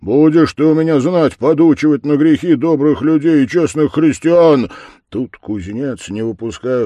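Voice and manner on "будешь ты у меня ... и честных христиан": loud, raised-pitch threat; blacksmith's forceful rebuke; angry scolding. A narrator's reading. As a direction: scold the devil at full voice. Blacksmith scolds the captured devil.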